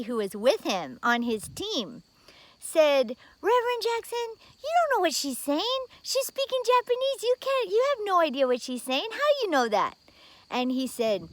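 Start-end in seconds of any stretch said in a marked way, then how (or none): 3.4-10.0 high-pitched doubting lady voice; incredulous colleague. Retold or imitated. Team lady doubting the reverend, high incredulous protest. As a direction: protest in a higher voice, disbelieving. Team member objects to Jackson.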